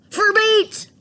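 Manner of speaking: high, loud cry of shock. Acted. Boy shocked that the calf goes to meat, crying out. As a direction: gasp it out in horror.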